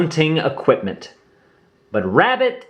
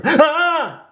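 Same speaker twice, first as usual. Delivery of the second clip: sudden high startled scream; a sharp frightened yelp, high up; short high scared shriek. A narrator's reading. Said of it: "screamed Roo".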